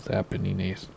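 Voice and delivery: "Apennines" softly, ending "nee-nays"; Italian-style Apennines. Shown as a demonstration. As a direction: say "Apennines" quietly with a final "nays". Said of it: "That's probably what it is".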